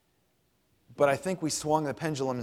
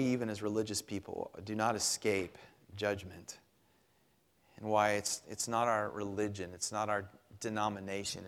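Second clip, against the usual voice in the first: prayer; 3 semitones lower, 4 dB quieter than usual. Acted. Pastor leading the congregation in quiet prayer. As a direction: pray quietly and humbly before God.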